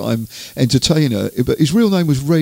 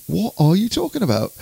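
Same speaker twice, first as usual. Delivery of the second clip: higher incredulous inner voice; baffled thought; 7 semitones higher than usual. Retold or imitated. Interviewer secretly baffled by the crop circle theory, incredulous. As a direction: act out private bafflement, pitched up. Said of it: "you know, thinking".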